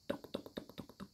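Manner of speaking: soft whispered knock knock; knocking sound. A narrator's reading. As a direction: tap out the knocks in a hush. Knocking at the door.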